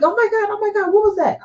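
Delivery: way up high, startled and alarmed. Retold or imitated. Wife imitating Kelly startled by the talking speaker, high alarmed voice. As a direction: jump at a voice from nowhere.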